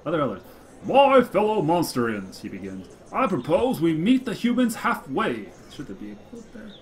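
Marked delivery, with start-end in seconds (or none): 0.8-2.4 slow, louder, self-important orator; pompous speech opening. Acted. Green Blitz opening his speech, pompous and slow. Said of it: "puffed up with multisyllable importance". 3.1-5.5 slow, louder, self-important orator; pompous speech opening. Acted. Green Blitz opening his speech, pompous and slow. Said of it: "puffed up with multisyllable importance".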